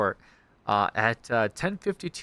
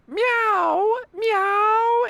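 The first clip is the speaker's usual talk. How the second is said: very high mewing meows; cat meow; 4 dB louder than usual. Retold or imitated. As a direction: mew like a kitten. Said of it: "she was like".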